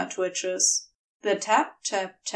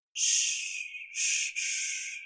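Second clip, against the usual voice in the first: a gentle hush to calm him; soft reassuring shh; comforting him out of a bad memory; soothing shushing; 5 dB quieter, much slower than usual. Crowley soothing flinching Aziraphale.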